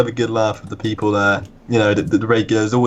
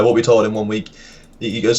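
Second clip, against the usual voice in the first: ordinary talk, nothing marked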